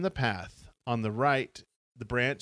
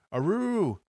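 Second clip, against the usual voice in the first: wolf voice; 8 semitones higher than usual. Acted. Howl again, kids scared.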